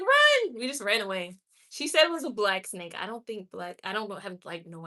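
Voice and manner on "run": high, loud sudden yell; a quick alarmed shout to flee; yelling run. Retold or imitated. Warning her daughter about the snake, loud alarmed yell. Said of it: "I was like".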